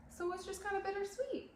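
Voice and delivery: real tears breaking through the words; tearful. Real, not acted. Salon owner saying goodbye to her salon, tearful. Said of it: "did not think I was gonna cry this much".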